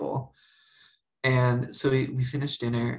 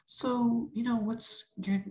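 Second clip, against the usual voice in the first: parent voice; 11 semitones higher, 3 dB quieter, slower than usual. Retold or imitated. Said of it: "they were like".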